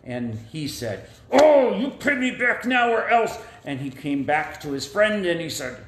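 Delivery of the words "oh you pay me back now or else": threatening. Acted. Creditor threatens friend.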